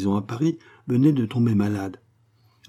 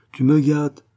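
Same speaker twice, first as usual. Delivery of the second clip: bright, raised cry of delight; a higher, louder exclamation of thanks; exclaiming; 6 semitones higher, 6 dB louder, slower than usual. A narrator's reading. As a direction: cry it out, touched and happy. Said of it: "s'écria Angèle".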